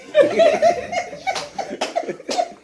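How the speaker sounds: high bursting real laugh. Real, not acted. Comic laughing at his own punchline, high laugh. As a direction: crack up at your own joke.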